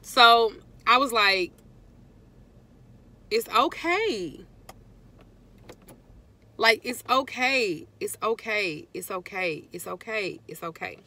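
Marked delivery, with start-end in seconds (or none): 3.3-11.0 reassuring. Retold or imitated. Soothing herself, repeating it's okay.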